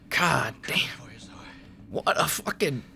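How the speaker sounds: hushed, breathless whisper; stunned whisper. Real, not acted. Gamer watching the brutal finishing move, in a stunned whisper. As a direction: barely breathe the words in shock.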